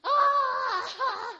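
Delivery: high, strained cry of desperation; desperate cry again. Retold or imitated. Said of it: "So I tried again".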